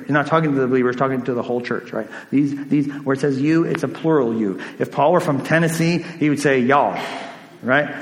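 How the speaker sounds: normal talking voice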